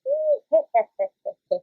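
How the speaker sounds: high, loud put-on cackle; nasally witch laugh. Shown as a demonstration. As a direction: demonstrate laughing through the nose. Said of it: "you can try laughing in this voice".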